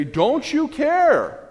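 hammed-up terrified apostles; desperate plea. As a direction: plead with panic in the voice. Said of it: "they go into the back and they say".

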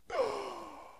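sharp high gasp for air, like a corpse waking; hammy revival gasp. Retold or imitated. Preacher mocking a movie corpse coming back, hammy gasp. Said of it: "the person's like".

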